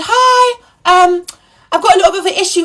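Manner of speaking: imitating her own overly nice self; sweet polite greeting. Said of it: "I said".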